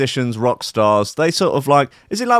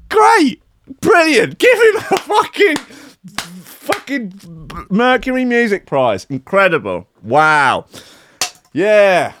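mock-cheer the award, sarcastic; sarcastic praise; 6 semitones higher, slower than usual. Real, not acted. Sarcastic about the prize.

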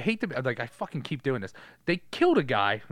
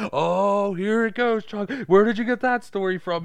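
mocking cops; 8 semitones higher, 6 dB louder than usual.

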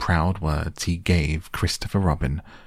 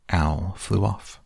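usual speaking voice

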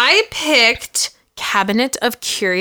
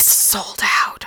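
disappointed whisper; 4 dB quieter, slower than usual. Real, not acted. Shirt she wanted is gone.

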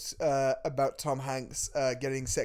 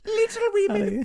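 very high, louder, laughing through it; squeaky mock father; 3 dB louder than usual. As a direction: squeal it, cracking up at yourself. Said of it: "where he comes and goes".

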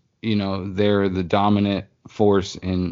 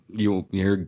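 usual speaking voice

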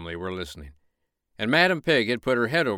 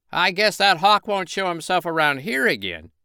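much higher, louder rooster voice; shaken rooster bluster; 9 semitones higher, 5 dB louder than usual. Acted. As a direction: brush it off as if you'd won. Rooster after hawk shot.

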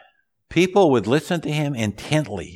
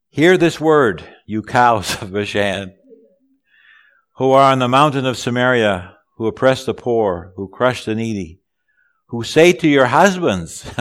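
loud, raised Bible-reading voice; scripture proclamation; 3 semitones higher, 6 dB louder than usual. Acted. Preacher reads Amos to congregation, loud raised proclamation. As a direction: proclaim the prophet's rebuke to the room.

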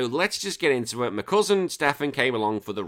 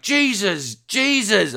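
put-on high, loud child's cry; imitated little girl; 9 semitones higher, 5 dB louder, much slower than usual. Little girl repeating it in the lift, imitated high shout. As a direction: parrot it like a delighted toddler, pitched up. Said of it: "this little girl's like".

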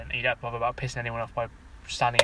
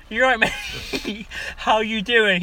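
amused greeting; 9 semitones higher, 3 dB louder, much slower than usual. Retold or imitated. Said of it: "I was like".

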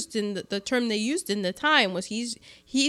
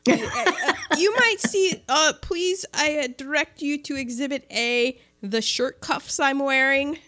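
grumbling reluctant resolve; 5 semitones higher than usual. Acted. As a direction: beg off, reluctant and higher. Acting out the nerdy station master.

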